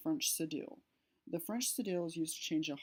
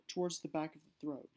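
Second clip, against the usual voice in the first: ordinary talk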